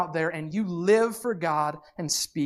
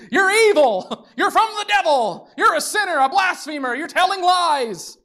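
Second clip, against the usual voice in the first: hammed-up outraged accusation, much higher and louder; mock accusing rant; 11 semitones higher, 5 dB louder than usual. Teachers of the law accusing Jesus, hammed-up outraged shouting. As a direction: fling the accusations with a laugh in it. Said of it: "their thought process is".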